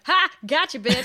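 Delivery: mock gloating. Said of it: "should have at the end of that night just been like".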